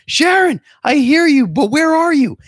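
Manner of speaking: put-on high, loud old-lady holler across a parking lot; old woman yelling. Old woman calls for her friend in the lot, high loud holler. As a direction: shriek the name like a lost grandma. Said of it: "yelling, yelling".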